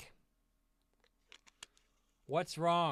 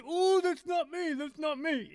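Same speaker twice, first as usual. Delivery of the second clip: high, slow mock voice of the subscriber; prissy high-pitched mock denial, drawn out; mocking whiny denial; 12 semitones higher, 5 dB louder than usual. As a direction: whine it out in a silly high put-on voice. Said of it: "And goes".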